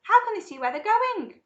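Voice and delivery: high, loud alarmed shriek. A narrator's reading. Violet shrieks into the darkness of the tunnel. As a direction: shriek the question into the dark. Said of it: "shrieked Violet Beauregarde".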